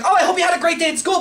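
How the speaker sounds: mock neighbor mom. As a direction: parody her sugary fake-caring tone. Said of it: "she could be saying everything like".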